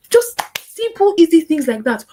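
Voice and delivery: emphatic teacher point. Real, not acted. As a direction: punch it out.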